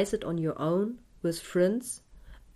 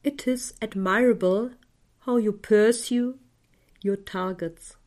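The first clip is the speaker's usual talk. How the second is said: guided meditation. Acted. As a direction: guide the listener gently into calm.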